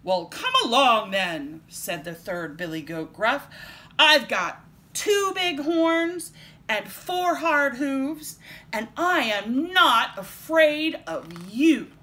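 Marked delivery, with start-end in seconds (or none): 0.0-1.6 loud, challenging goat; big loud voice. A narrator's reading. Third goat daring the troll, big and loud. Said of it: "as big and loud as the troll's". 4.0-11.9 slow, loud, defiant big goat. A narrator's reading. Third goat defying the troll, big and loud. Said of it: "as big and loud as the troll's".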